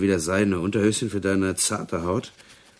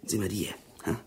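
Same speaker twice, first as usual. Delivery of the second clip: conspiratorial whisper; 2 semitones higher, 7 dB quieter, faster than usual. Acted. Cellmate shows his photo to the prisoner, whispering.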